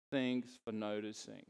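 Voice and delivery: mildly glum, sarcastic drawl; a hint of mopey Eeyore; glum donkey voice. Preacher imitating the glum donkey, mildly mopey. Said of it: "ever glum, sarcastic".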